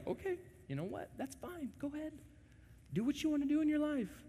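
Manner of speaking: high, soft, quick resignation; God giving up on arguing; resigned letting go. Retold or imitated. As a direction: throw up your hands and let them go.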